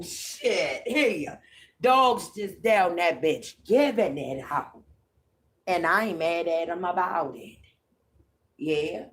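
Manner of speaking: usual speaking voice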